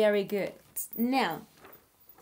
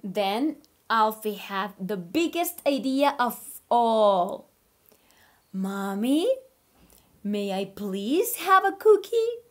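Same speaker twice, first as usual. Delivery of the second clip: put-on reading to children; 3 dB louder than usual. Teacher reading a story to her online class, animated. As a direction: read aloud like a picture book for little kids. Reads picture book aloud.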